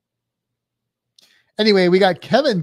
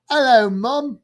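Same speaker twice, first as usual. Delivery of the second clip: higher, louder British hello; British accent; 7 semitones higher, 6 dB louder than usual. Shown as a demonstration. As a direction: put on a playful British accent. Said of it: "kind of the British version".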